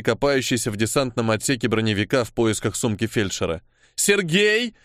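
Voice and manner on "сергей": raised-pitch, contained shout; called out. A narrator's reading. Apostle summoning the scout, urgent higher call. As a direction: call his name higher, more urgently. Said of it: "крикнула апостол".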